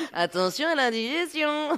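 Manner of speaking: high, loud joking alarm; laughing mock warning. Host teases guest who only goes to eat, laughing mock warning. Teasing about only eating at parties.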